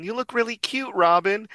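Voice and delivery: put-on very high kid voice; Bonnie. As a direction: squeal it in a high child's falsetto. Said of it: "Bonnie is saying".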